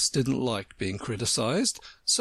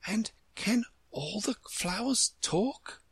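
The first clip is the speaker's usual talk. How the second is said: timid; 11 semitones higher, slower than usual. A narrator's reading. Alice shyly addressing the Tiger Lily, timid, almost whispering. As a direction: ask it timidly, slowly and high. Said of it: "in a timid voice, almost a whisper".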